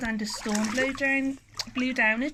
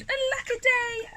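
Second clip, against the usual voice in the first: very high storybook lament; crying out. A narrator's reading. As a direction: wail lightly like a little creature. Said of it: "And he cried".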